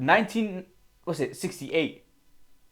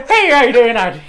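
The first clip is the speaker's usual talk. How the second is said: bad, loud mock Irish accent; hammed-up Irish accent, louder; 9 semitones higher, 14 dB louder than usual. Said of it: "worst Irish accent I've ever heard".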